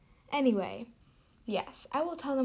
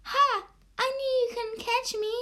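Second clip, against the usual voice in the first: much higher, loud gloating; high trickster voice; 12 semitones higher, 7 dB louder than usual. Acted. The stranger gloats at Maya, much higher and loud. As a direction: crow it in a squeaky voice.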